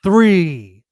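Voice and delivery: loud, punchy countdown. Acted. Cohost leads a countdown for the new laugh, loud and punchy. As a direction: call the countdown out big. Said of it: "let's do a countdown".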